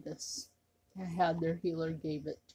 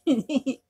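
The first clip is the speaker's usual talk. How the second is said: burst of laughter; 7 semitones higher, 10 dB louder than usual. Real, not acted. Laugh after the whisper.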